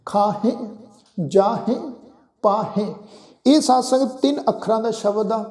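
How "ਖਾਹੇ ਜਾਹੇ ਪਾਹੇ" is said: ਖਾਹੇ, ਜਾਹੇ, ਪਾਹੇ with a plain e ending; each word slow and separate, ending in e; pronunciation demo. Shown as a demonstration. Teacher listing example words with the lāṅ sound. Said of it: "ਇੱਕ ਲਾਂ ਦੇ ਪਾਠ ਵਿੱਚ ਉਚਾਰਨ".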